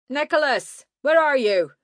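high, loud call; searching and calling loudly. Acted. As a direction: shout for him from a distance. Mage calling out.

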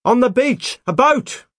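loud, high-pitched shout; calling out. A narrator's reading. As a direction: holler it urgently across the distance. Calling down to his wife.

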